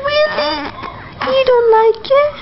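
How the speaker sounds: high pretend baby voice; voicing the baby. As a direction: speak for the baby, high and sweet.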